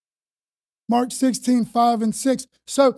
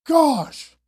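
overwhelmed awe; much slower than usual. Retold or imitated. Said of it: "I'm like".

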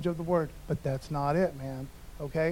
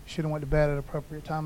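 normal talking voice

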